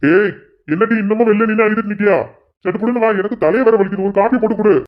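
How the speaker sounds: high, impatient shout at the wife; husband calling his crying wife in, annoyed; irritated yelling. Acted. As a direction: bark the order from inside the house. Husband yells at wife outside.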